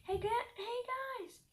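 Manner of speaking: high-pitched shout to the animals; calling out. A narrator's reading. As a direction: holler to get their attention. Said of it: "I shouted to the grazing animals".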